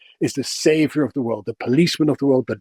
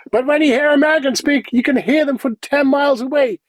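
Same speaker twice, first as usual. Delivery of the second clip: high, projected, carrying voice; loud American parody; 11 semitones higher, 3 dB louder than usual. Shown as a demonstration. Dutch guest showing how far Americans are heard, loud and high. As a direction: send it out for miles, pitched way up. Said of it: "cliche of the loud American".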